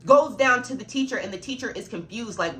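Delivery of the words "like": usual speaking voice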